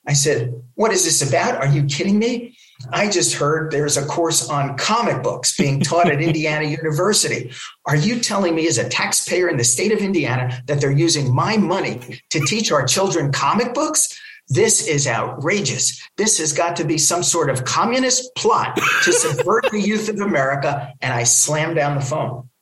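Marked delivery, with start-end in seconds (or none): 0.7-1.5 higher-pitched, incredulous echo of the question; mock indignant scream. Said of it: "I started to scream at the guy". 1.6-20.9 higher-pitched, hammed-up outrage; mock screaming tirade. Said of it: "I started to scream at the guy".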